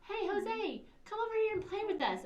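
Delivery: put-on high and quick, eager kid voice; friendly invite. Principal acts a classmate welcoming the new kid, high and eager. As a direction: call a new kid over to play.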